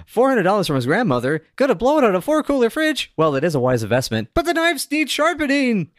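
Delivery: mock character voice. Reading notes in a mock voice.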